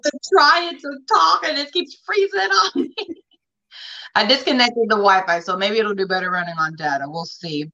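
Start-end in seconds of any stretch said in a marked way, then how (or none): 0.0-2.9 high, whiny mock complaint, half crying; mock whine. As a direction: whine it like a frustrated kid near tears. Complaining about frozen phone.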